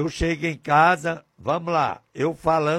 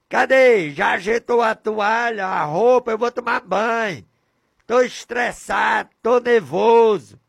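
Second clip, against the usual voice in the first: higher, louder angry yelling; furious shouting husband; 7 semitones higher, 4 dB louder than usual. Acted. Angry husband yelling at his wife through the locked gate. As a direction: bark at the wife to open the gate. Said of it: "gritando com ódio, com raiva, com ira".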